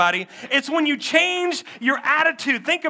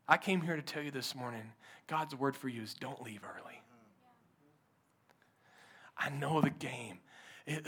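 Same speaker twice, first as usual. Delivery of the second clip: soft, breathy, hushed sermon voice; whispered preaching; 10 semitones lower, 14 dB quieter than usual. Acted. Pastor whispering to the hurting congregation, hushed whispered preaching. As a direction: lean in and whisper the promise.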